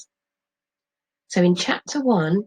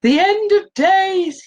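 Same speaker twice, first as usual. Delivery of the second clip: much higher and louder, slow wail; fearful cry; 13 semitones higher, 7 dB louder, slower than usual. Acted. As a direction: cry out like a spooked old prophet. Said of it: "he cries".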